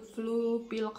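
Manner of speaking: ordinary talk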